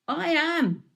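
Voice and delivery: high, loud proclamation; Jesus declares it boldly; short, ringing answer, high and loud; firm declaration. A narrator's reading.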